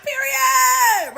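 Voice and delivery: high, gleeful squeal; drawn-out high cry of approval; hyped-up cheer. Retold or imitated. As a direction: squeal it out in triumph. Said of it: "I can't get too hyped. But I'm like".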